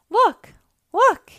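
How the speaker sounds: high, very loud repeated call; excited shout. A narrator's reading. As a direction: shout to grab their attention. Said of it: "he shouted".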